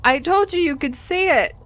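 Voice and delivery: loud, high indignant protest; exasperated, raising the voice; mock outrage. Real, not acted. Player protesting that the charm was eaten.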